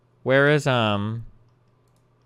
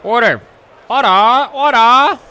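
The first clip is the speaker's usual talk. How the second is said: high, loud calls of order, building; mock order shout; 13 semitones higher, 14 dB louder, slower than usual. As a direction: bang the gavel with your voice. Mimicking the gavel call.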